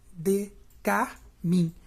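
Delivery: "de carmim" slow, in three separate beats; syllable by syllable. Shown as a demonstration. Teacher reading the last verse, "de carmim" split into syllables. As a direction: leave a gap after each syllable of "de carmim". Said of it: "a sílaba mais forte é o mim".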